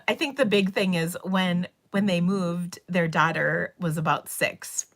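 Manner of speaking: ordinary talk, nothing marked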